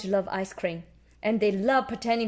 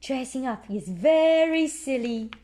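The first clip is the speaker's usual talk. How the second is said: usual speaking voice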